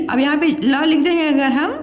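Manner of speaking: usual speaking voice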